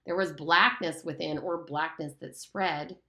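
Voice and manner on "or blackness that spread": ordinary delivery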